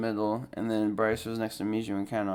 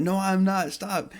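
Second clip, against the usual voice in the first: high-pitched whiny denial; mock protest; 9 semitones higher, 3 dB louder, slower than usual. Telling his brother to stop, high mock protest.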